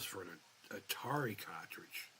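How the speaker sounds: breathless whisper of disbelief. Real, not acted. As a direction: whisper it in shock.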